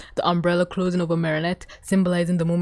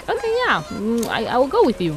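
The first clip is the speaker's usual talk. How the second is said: flustered mimicry; 4 semitones higher than usual. Acting Marinette's flustered answer.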